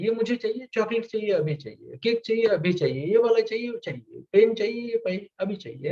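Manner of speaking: ऊँची आवाज़ में ज़िद करता बच्चा; एक ज़िद्दी बच्चे की हल्की नकल, ऊँचे सुर में; demanding child. Retold or imitated. Parent describes a demanding child. (बच्चा माँ-बाप से अभी चॉकलेट माँगता है, ऊँची ज़िद भरी आवाज़ में)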